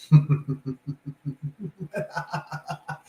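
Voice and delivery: long staccato laugh; incredulous laugh. Real, not acted. Installer hears the dent accusation, long incredulous laugh. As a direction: laugh it out in a drawn-out run of short bursts.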